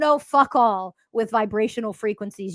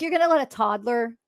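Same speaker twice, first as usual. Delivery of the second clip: usual speaking voice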